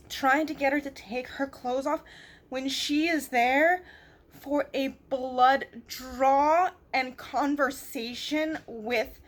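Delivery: real loud exasperated venting; angry rant. Real, not acted. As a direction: let the outrage build and spill.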